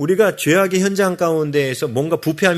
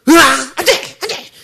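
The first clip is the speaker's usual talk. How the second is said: mock panic; 11 semitones higher, 6 dB louder, faster than usual. Preacher acts out fleeing sin in panic.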